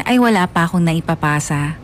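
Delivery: ordinary delivery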